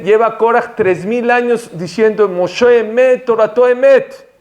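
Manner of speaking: high, emphatic proclamation; impassioned preaching. Acted. Preacher marveling at Korach's endless confession, impassioned high delivery. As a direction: proclaim it, climbing and drawing out the last words.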